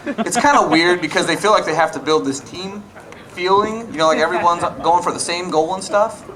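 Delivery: ordinary talk, nothing marked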